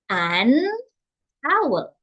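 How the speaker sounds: put-on slow, clear "An owl." as a model for a child; teacher modelling "An owl." for a young learner; careful model word. Shown as a demonstration. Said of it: "can you say".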